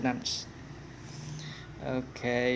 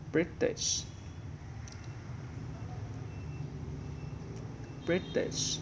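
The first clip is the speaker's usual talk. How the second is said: whispered British, under the breath; whispered to self; 4 semitones higher, 9 dB quieter than usual. Real, not acted. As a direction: breathe British while hunting for it. Muttering while searching the grid.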